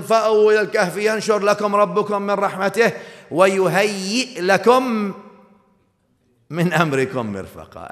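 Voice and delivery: fast, loud chanted recitation of the verse; Quran recitation. Acted. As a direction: intone the verse quickly and firmly.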